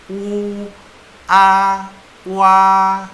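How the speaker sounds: "U", "A", then "wa", each long and high; syllable sounded out. Shown as a demonstration. Teacher blending U and A, long level "wa". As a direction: hold "U", "A", "wa" on one level tone. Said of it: "U itu sama dengan W".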